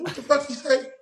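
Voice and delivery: the second time, now you're fuming; outraged disbelief. Retold or imitated. Escalating disbelief after rewind.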